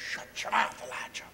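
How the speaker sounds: acted whispered grumble; whispered retort. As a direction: hiss it under your breath. Prophets mutter back at Elijah.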